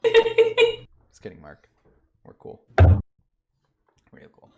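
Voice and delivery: laughing through the words; high, slow laughing apology. Real, not acted. Laughing mock apology to friend.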